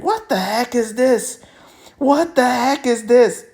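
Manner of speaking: high, drawn-out mock disbelief; baffled reaction.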